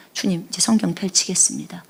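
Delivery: soft unvoiced whisper to God; whispered prayer. Shown as a demonstration. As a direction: whisper it before opening the Bible. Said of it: "그러면 어떻게 해요?".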